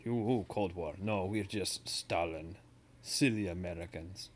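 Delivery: teacher hamming up a Russian; jokey classroom Russian voice; Russian accent. Retold or imitated. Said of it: "my, my Russian voice".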